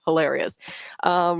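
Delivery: normal talking voice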